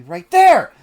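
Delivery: loud burst on the last word; sudden shout. Acted. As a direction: call it out sharply to misdirect. Calling out a feint, pointing.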